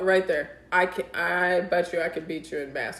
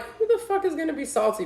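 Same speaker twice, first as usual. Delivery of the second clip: high-pitched incredulous comeback; retold scoff, pitched way up; mock indignant; 11 semitones higher than usual. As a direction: jump up high in mock disbelief. Retelling her indignant comeback to trash talk.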